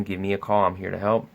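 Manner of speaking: usual speaking voice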